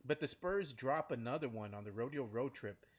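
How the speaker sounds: ordinary talk, nothing marked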